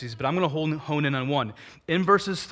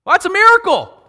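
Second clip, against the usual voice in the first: much higher and louder, nearly shouting; exclaiming; 15 dB louder than usual. Real, not acted. Preacher calling out the joke's punchline to the congregation, much higher and louder. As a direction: burst out with the punchline.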